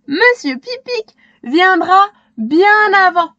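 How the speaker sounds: put-on little girl answering with a laugh in her voice; Shéna amused. A narrator's reading. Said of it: "Shéna éclate de rire".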